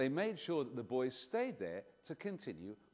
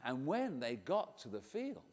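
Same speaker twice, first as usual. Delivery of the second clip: plain reading voice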